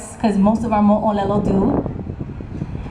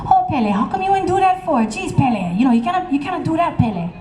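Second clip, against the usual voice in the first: scolding sisters; 7 semitones higher than usual. Acted.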